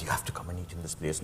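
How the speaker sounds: hushed, breathy aside; letting a friend in on a secret; retold whisper. Telling a friend from San Francisco about the place, in a whisper.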